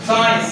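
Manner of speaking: साइंस with no separate C sound; silent C. Shown as a demonstration. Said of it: "सी को नहीं पढ़ते".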